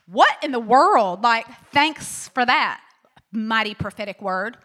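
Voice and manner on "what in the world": louder, bewildered "what in the world"; exasperated outcry. Retold or imitated. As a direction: throw up your hands in disbelief. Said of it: "They're like".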